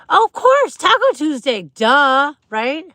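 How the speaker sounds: high, loud burst of sudden recognition; oh of course, it's obvious; excited realisation. Real, not acted. Host realizing to chat that it's Taco Tuesday, excited.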